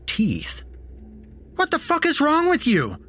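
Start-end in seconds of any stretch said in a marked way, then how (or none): 1.6-3.0 very high, outraged yell. A narrator's reading. Narrator to the man biting his arm, yelling. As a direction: yell it in pain and disbelief. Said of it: "I yelled at the man".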